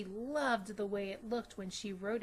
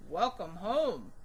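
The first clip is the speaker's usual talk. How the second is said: slow, louder hearty greeting; father voice; 2 semitones lower, 7 dB louder than usual. Acted. Father welcoming his daughter home, slow and hearty. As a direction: greet her fondly.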